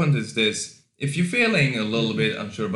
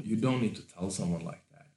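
ordinary talk

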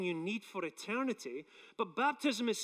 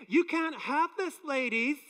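sassy warning; 6 semitones higher, 4 dB louder than usual. Pretend. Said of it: "this is my way of saying".

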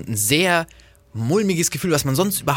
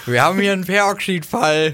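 mock official voice; 6 semitones higher, 4 dB louder than usual. Acting out a store alarm.

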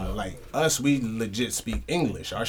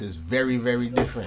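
ordinary delivery

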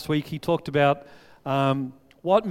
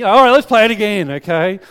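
loud, high, keen to go again; eager; 6 semitones higher, 13 dB louder than usual. Retold or imitated. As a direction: push for another round. Eager kid wanting another round.

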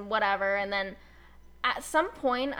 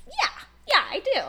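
eager; 6 dB louder than usual. Retold or imitated. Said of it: "And I was like".

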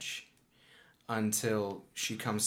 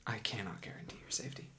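lightly voiced guardian, hushed and grave; cautious magus; faster than usual. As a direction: trail down to near-whispered warnings as you lead them on. Game master voices the magus guiding players.

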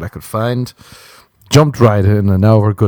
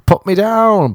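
eager yes; 11 semitones higher, slower than usual. Retold or imitated. Eager to be on the show.

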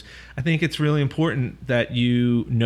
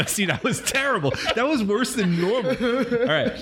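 laughing; 10 semitones higher than usual. Real, not acted. Laughing at own attempt.

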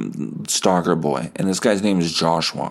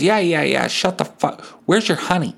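put-on impatient old lady; 9 semitones higher than usual. Old lady rants at boy.

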